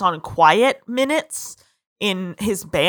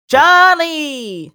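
higher, cheery call of a name; 5 semitones higher than usual. Retold or imitated. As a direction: call out like a friendly Brit. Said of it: "This great impression".

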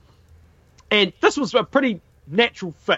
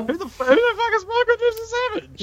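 high fast baffled voice; mock bewildered squeak; faster than usual. Mocking confused listener.

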